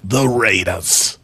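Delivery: low, slow, drawn-out announcer voice; Chris Berman impression. Doing a Chris Berman impression for a co-host, deep and slow. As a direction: stretch out the Raiders like Berman. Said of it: "is that your Chris Berman impression".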